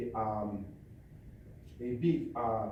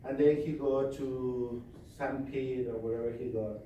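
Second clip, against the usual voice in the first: normal talking voice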